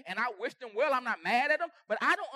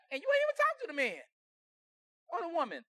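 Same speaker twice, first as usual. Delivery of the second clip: pitched way up, climbing to a preacher's cry; high preaching cry; 3 semitones higher than usual. Acted. Pastor crying out to the hurt congregation, high and ringing. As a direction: throw it up high like you're preaching hard.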